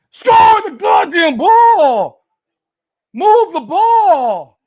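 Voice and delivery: high, drawn-out furious yell; upset shouting. Real, not acted. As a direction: bellow at the team, stretching every word. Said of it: "I'm sorry I'm getting upset here".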